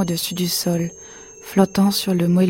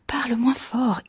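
higher, softer, whispery plea; hushing; 5 semitones higher, 5 dB quieter than usual. A narrator's reading. Wife hushing her shouting husband, hushed plea. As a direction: hush him urgently under your breath.

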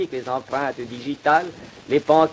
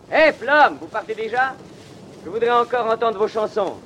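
calling out; 9 semitones higher than usual. Acted. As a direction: call out to stop him leaving. Calling after the departing singer.

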